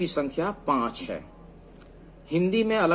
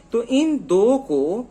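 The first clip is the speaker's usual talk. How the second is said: slow emphasis; 6 semitones higher, 5 dB louder, much slower than usual. Shown as a demonstration. Teacher stresses key term slowly.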